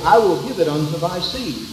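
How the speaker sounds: ordinary talk, nothing marked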